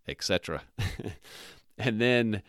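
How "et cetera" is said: ordinary talk, nothing marked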